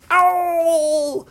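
long, high yelp of "ow"; loud cry of pain. A narrator's reading. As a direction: howl out in acted pain. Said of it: "so loud".

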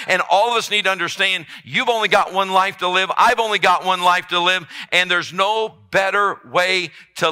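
loud, high, driving sermon delivery; preaching at full force. Acted. Pastor urging his flock not to feel wasted, preaching hard. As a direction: pound every phrase out to the back pews.

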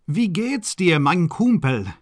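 high, squealed German greeting; excited squeal. Acted. Baron greeting his cousin Sandor, high excited squeal. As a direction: squeal hello to a favorite cousin. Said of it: "he squealed".

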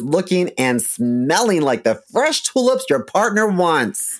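upbeat sponsor ad read. Acted. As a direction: sell it like a radio spot. Reads a sponsor ad.

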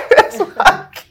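high, loud laughing burst; loud laugh breaking into words; burst of laughter. Real, not acted. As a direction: crack up with relief. Laughing at the lucky news.

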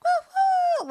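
high, loud hawk cry; hawk call imitation. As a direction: screech it out like a hawk. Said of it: "I don't know how a hawk sounds".

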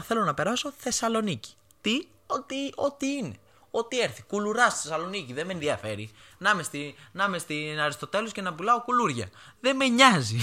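high-pitched, excited insistence; eager insistence. Retold or imitated. Student saying any school in Thessaloniki will do, eager and high-pitched. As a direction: act out wanting it at any cost.

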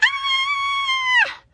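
piercing high cry of shock; shrill scream. Acted. Mother goat finding her house ransacked, shrill scream. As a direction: scream in horror. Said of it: "screamed the mother goat".

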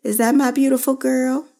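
put-on a high, bright-pitched mom calling her girl; light imitation of mom, sweet and fond; mother's loving greeting. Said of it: "she greeted me every time".

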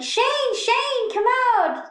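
high-pitched mock calling for someone. Acting out Carmen calling for Shane.

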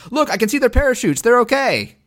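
high loud cheerful yell. Retold or imitated. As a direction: shout it up high and bright. Quoting Tien yelling.

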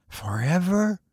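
solemn. A narrator's reading. The stranger asks Wolfgang, solemn and hushed. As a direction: ask it softly, gravely. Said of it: "said the stranger solemnly".

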